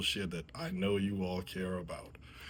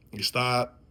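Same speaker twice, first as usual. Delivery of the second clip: normal talking voice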